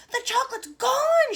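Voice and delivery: high, loud, astonished shout. A narrator's reading. Grandpa Joe shouting to everyone as chocolate vanishes, astonished. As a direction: cry it out in amazement. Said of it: "shouted Grandpa Joe".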